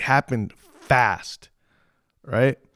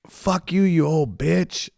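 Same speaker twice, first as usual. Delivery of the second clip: higher, rough mock yell of a drunk girl; 6 semitones higher, slower than usual. Young drunk woman insulting older ladies, mock yell. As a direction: throw the insult like a drunk patron.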